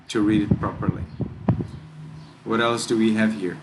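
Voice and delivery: usual speaking voice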